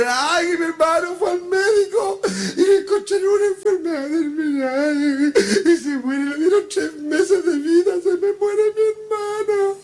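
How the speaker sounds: high, drawn-out comic bawling; mock crying. Friend with a terminally ill brother, mock bawling. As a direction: wail it through exaggerated sobs, stretching the endings. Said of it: "venía llorando y dice".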